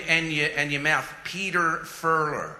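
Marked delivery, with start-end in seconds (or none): 1.2-2.6 American accent. Shown as a demonstration. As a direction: make mouth and tongue work hard on American r's. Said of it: "focus on your tongue".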